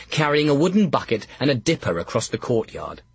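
plain reading voice

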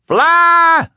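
high, loud full-force scream. A narrator's reading. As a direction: scream it as a desperate command. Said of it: "the kid screamed".

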